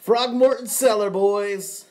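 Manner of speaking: excited announcement. Real, not acted. Announcing the tobacco to viewers.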